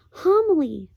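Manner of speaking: high call of Homily. Acted. The boy calls Homily down the hole, high.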